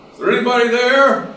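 high, loud desperate call into the void; a man hanging over a cliff calling for help. Acted. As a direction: shout it out over the abyss, hoping someone hears. Joke, man calling out over abyss.

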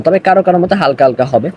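ordinary delivery

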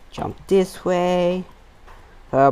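usual speaking voice

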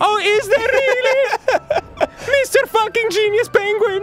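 high, loud mocking squeal; mock his genius in a shrill squeal; sarcastic squeal. Mocking the game penguin with a high loud sarcastic squeal.

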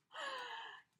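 high, drawn-in gasp of surprise; delighted gasp. Real, not acted. Finding a gift in the box, delighted gasp.

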